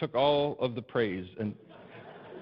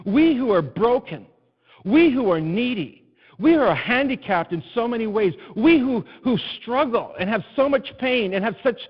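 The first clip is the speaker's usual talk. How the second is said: full-force preaching; 9 semitones higher, 10 dB louder than usual. Acted. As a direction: preach it out to the whole room.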